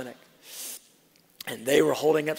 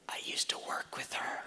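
whispered aside to husband; 9 dB quieter than usual. Retold or imitated. Wife whispering to husband at the clinic, hushed whisper. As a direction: lean in and whisper it. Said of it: "She goes".

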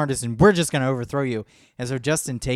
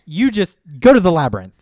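louder, firm banishing order; loud command; 4 semitones higher, 11 dB louder than usual. Retold or imitated. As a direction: send him off with a loud order. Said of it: "he's like".

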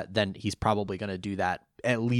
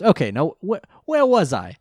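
high, loud cocky cartoon line; cartoon character voice; 6 semitones higher, 7 dB louder than usual. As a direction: play it big and cocky.

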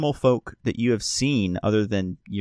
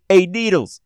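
high, raised hail to a colleague; calling out; 11 semitones higher, 6 dB louder than usual. Acted. Doorman to Needles for door cover, calling out high. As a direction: call over to your coworker, pitched up. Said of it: "he goes and goes".

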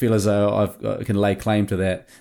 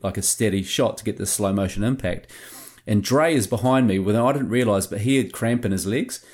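ordinary talk

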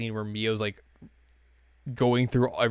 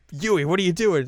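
higher, louder mock exasperation; retold scolding; 7 semitones higher, 5 dB louder than usual. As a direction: call her out, playfully annoyed. Acted scolding from booth.

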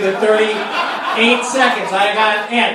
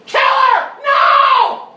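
much higher, louder shriek at full lung; a woman screaming in terror out in the woods; bloodcurdling scream; 5 dB louder, much slower than usual. Retold or imitated. A hiker in the woods hears a woman shrieking in terror. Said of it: "screamed at the top of her lungs, blood curdling".

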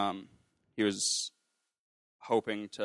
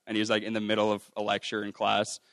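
usual speaking voice

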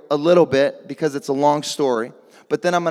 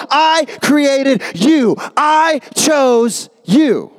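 slow booming God voice; 6 semitones higher, much slower than usual. Acted. Preacher voicing God to the congregation, slow and booming. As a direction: declare it slowly like God himself. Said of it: "And God said".

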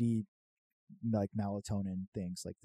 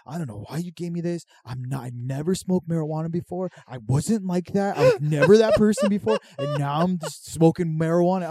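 mom cussing me out; 5 semitones higher, 9 dB louder than usual. Retold or imitated. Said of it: "cussing me out. She just got so mad".

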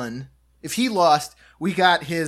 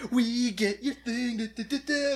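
taunting singsong; 6 semitones higher, 6 dB quieter than usual. Retold or imitated. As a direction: rub it in playfully. Taunting the loser.

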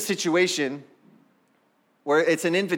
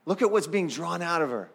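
ordinary talk, nothing marked

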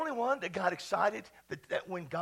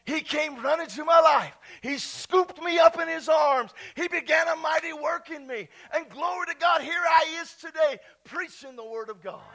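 loud, higher-pitched preacher's testimony; rousing testimony preaching; 8 semitones higher, 10 dB louder than usual. Real, not acted. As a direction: proclaim it with rising fervour. Preaches testimony at full force.

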